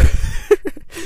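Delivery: high, loud burst of laughter; awkward laugh. Real, not acted.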